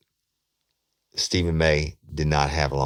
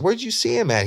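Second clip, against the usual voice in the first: eager; 3 dB louder than usual. Retold or imitated. Detective's urgent questioning.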